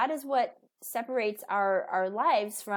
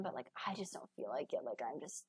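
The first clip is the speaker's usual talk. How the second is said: low, breathless whisper; whispered excuse; 7 semitones lower, 12 dB quieter than usual. Retold or imitated. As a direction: whisper it like you're exhausted. Tired parent's inner excuse.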